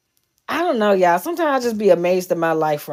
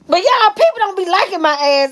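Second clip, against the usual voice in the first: much higher, laughing the words out; 11 semitones higher, 3 dB louder than usual. Real, not acted. Telling followers people dislike her, high and laughing. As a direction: squeal it through laughter.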